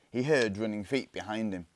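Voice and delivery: ordinary delivery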